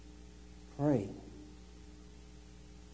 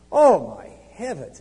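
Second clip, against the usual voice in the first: louder and slow, feigned astonishment; mock shock; 5 semitones higher, 15 dB louder, slower than usual. Preacher feigns astonishment at John's answer, hammed-up shock. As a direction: gasp it out in fake amazement.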